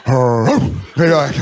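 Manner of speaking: drawn-out mock growl; imitated dog growling. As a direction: growl like two circling dogs. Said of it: "like two dogs circling each other".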